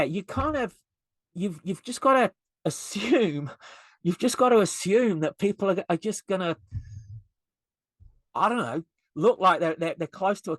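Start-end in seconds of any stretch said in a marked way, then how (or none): none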